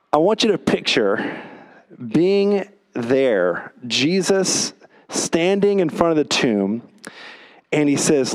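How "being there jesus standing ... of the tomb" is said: normal talking voice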